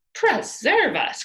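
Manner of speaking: loud, slow, drawn-out exclamation; crying out. A narrator's reading. Loud, slow startled cry. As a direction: Pippi cries out in shock at the cost of looking, loud and slow. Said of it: "cried Pippi".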